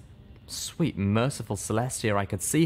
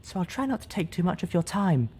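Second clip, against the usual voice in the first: a higher, gentle character voice; 10 semitones higher than usual. A narrator's reading. Figure voice in story.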